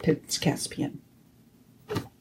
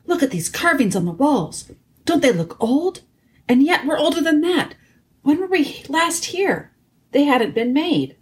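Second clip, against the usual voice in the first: put-on higher-pitched boyish voice of Edmund; wondering boy; 9 semitones higher than usual. A narrator's reading. Edmund marvels to Peter at old carvings, wondering.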